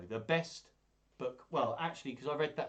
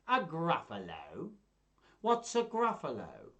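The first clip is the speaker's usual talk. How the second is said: slow then high puzzled fox; 5 semitones higher, 4 dB louder, slower than usual. Acted. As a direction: ask it baffled. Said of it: "do all the voices".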